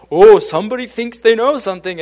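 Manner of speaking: loud, high, sarcastic sneer; mock him as a know-it-all; mock sarcasm. Coworkers teasing Stasik for using medical terms, loud and sarcastic. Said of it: "we'd pretend like we knew everything and be like".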